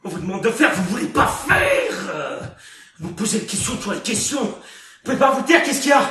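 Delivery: loud, exasperated questioning; heated scolding; angry berating. Acted. Director keeps berating the cast.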